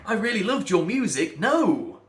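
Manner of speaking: high, loud, mock disbelief; rising in indignant disbelief; incredulous outburst. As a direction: scoff at the very idea, loud and high. Mock outrage at bad playing.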